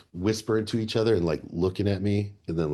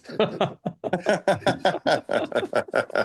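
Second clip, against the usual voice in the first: laughter; 11 semitones higher than usual. Real, not acted. Laughing at his own impression.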